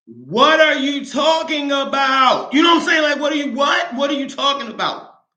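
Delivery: disbelieving shout. Retold or imitated. To her husband hearing she died, a high shocked shout. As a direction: yell it, stunned and frantic.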